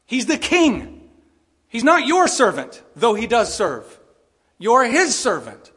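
slow, loud and high, each word weighed; slow ringing preacher's proclamation; emphatic proclamation. Real, not acted. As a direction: declare it like a herald. Preacher proclaiming God's kingship.

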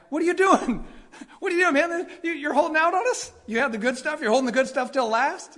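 high, bright teasing questions, amazed; excited ribbing. Retold or imitated. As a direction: playfully accuse the groom of hiding the good stuff. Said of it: "Like, he's excited".

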